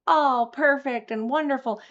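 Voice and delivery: high sing-song parody; mocking fake-cheerful. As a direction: sneer at the perfect-life act. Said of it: "making it sound like".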